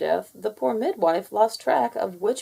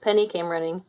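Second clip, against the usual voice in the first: plain reading voice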